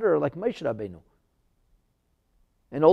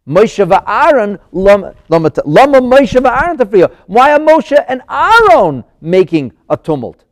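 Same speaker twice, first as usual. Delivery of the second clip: very loud, raised-pitch protest; forceful complaint; 7 semitones higher, 17 dB louder than usual. Acted. Pharaoh grumbling about Moshe and Aaron's tumult, loud and forceful. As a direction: declaim the complaint at full force. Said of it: "Instead, he said".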